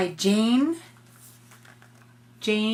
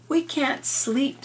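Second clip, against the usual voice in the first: put-on higher, slow plaintive girl; lightly voiced little girl; 7 semitones higher, slower than usual. Lucy telling Turtle they can't sleep, light plaintive girl voice. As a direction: say it like a sleepy girl asking for help.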